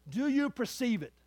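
usual speaking voice

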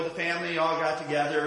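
ordinary talk, nothing marked

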